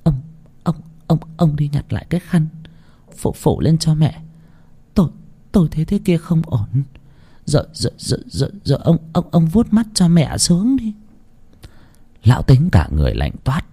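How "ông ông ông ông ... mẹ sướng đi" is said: higher, softer, stuttering in fear; a frightened wife begging her husband; shaky stammering plea, higher and quieter; trembling stammer. A narrator's reading. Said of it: "Mụ Toán cũng run rẩy".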